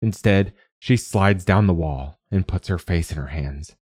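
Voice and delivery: ordinary delivery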